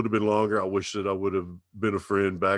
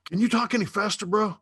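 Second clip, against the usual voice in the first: much higher, fast mocking whine; impatient city guy; 8 semitones higher than usual. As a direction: hurry him along like a fed-up rushed listener.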